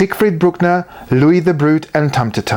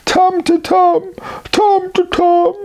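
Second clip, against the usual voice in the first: very high sobbing wail; Tum-to-Tum pinned, sobbing high. A narrator's reading. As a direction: sob his own name out. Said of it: "Tum-to-Tum sobbed".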